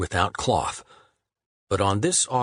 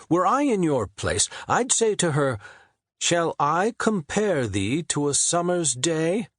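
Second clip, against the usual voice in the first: Shakespeare composing; 9 semitones higher than usual. A narrator's reading. Shakespeare offering his sonnet line to Harvard. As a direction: unveil the line as a gift.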